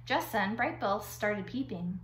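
ordinary delivery

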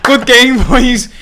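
high, loud, laughing mock-sporting praise; laughing congratulations. After losing fifteen three, laughing loud mock congratulations. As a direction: congratulate the team while cracking up.

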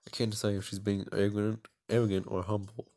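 ordinary talk

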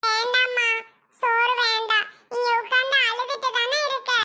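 put-on concerned little child. Child refusing food because mother was crying, concerned. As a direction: speak up as a worried child.

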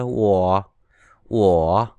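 ủa low and pressed; third tone ủa. Shown as a demonstration. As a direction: drop ủa low. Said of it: "Thanh ba thì như dấu huyền thôi".

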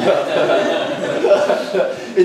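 breathy imitated laughing. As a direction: mimic the nephew laughing on the ride. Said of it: "He's just laughing".

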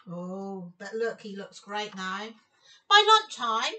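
plain reading voice

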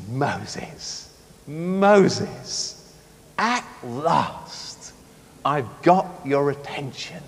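soft, low, loving call; tender God. Acted. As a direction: speak gently and unhurriedly, relieved to be heard. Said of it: "this is how he said it".

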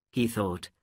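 ordinary delivery, nothing marked

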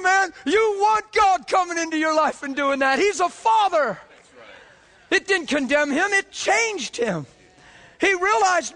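fired-up revival preacher; preaching at full force. Acted. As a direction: preach it out with growing fire.

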